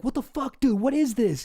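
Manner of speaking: high startled what the fuck; mock outrage, voice jumping up high; shocked. Roommate confronted with the mess, asks in mock disbelief. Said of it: "you're just like".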